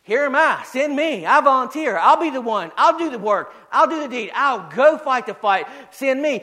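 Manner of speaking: eager, emphatic declarations in short bursts; impassioned preacher acting an answer to the call; Isaiah volunteering. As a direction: step up and volunteer with rising fervour. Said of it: "Isaiah said".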